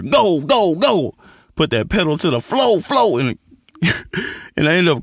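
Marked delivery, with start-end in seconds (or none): 0.0-1.1 pushing voice. Retold or imitated. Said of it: "Hell, I hear".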